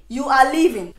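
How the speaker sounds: loud, firm eviction; angry order. Acted. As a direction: throw someone out with a loud order. Throwing Cindy out.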